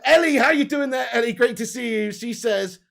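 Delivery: usual speaking voice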